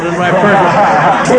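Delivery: usual speaking voice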